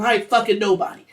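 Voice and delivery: loud, fast indignant denial. Acted. Wife denying the affair to husband, indignant. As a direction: fire back loudly and fast. Wife's acted angry denial.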